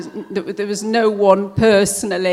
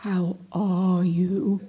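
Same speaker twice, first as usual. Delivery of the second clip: slow, drawn-out mock concern; underlined voice; much slower than usual. Poet mimicking what not to say, underlined voice. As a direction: stretch each word with heavy emphasis. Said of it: "in an underlined voice".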